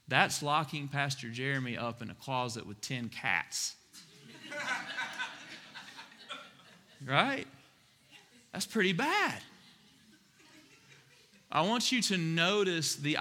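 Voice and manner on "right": soft laugh. Real, not acted. Laughs at his own joke.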